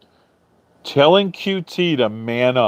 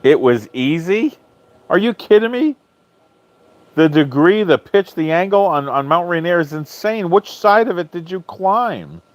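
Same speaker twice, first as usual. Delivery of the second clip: much higher, incredulous protest; can't believe what he's hearing; stunned pitch jump at a viewer's claim; disbelief; 7 semitones higher than usual. Real, not acted. Disbelief at climb being called easy.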